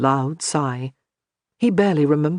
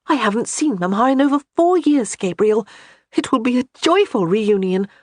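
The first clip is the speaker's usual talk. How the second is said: higher, a lightly voiced excited wife; eager wife; 6 semitones higher, 4 dB louder than usual. A narrator's reading. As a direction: insist it's happy news. Johanna explains.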